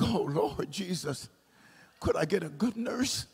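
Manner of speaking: very soft aside to the Lord; dismayed private prayer. Retold or imitated. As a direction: retell it as a hushed, weary plea. Retold dismayed prayer under breath.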